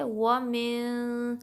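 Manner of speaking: وَمِن slow, n held as nasal hum; hidden noon. Shown as a demonstration. Teacher demonstrating ikhfa, وَمِن with long hum. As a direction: stretch the hidden n ending وَمِن. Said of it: "نون کو ہائیڈ کرنا ہے".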